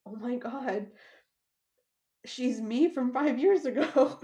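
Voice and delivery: amused exclaim to the owner; excited realisation. Retold or imitated. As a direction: land it like a funny revelation. Recognising herself in the horse.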